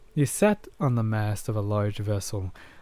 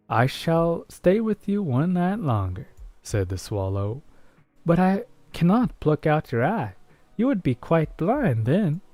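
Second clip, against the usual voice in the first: higher, gentle bird voice; swallow; 7 semitones higher than usual. Acted. Swallow telling the prince it cannot pluck his eye, higher voice.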